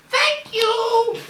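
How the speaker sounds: squeaky high little voice; talking wine voice. Acted. The rosé thanks the woman, squeaky and high. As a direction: pipe it like a tiny drink. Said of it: "The rosé begins to speak and says".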